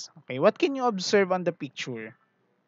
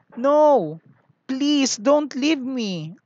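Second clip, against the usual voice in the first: much higher, slow, mild pleading; begging boy; 8 semitones higher, 5 dB louder, much slower than usual. Acted. Damien begs his toys not to go, mildly pleading. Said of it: "begged Damien".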